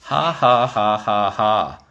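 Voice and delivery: imitating a sneering ha ha ha; mock a smug laugh at someone. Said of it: "and they would just say".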